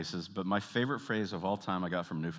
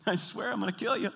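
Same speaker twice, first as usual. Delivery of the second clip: high-pitched, squeezed pretend menace; comic high-voiced spouse making threats; mock threat; 13 semitones higher than usual. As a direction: threaten playfully in a raised voice. Acted couple's threats in car.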